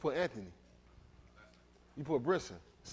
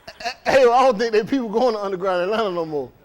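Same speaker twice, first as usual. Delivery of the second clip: laughing; 5 semitones higher, 10 dB louder than usual. Real, not acted. Laughing at Hayward's claim.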